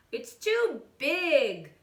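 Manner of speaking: slow whiny complaint; complaining. Acted. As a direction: drag it out, complaining. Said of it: "complained the gatito".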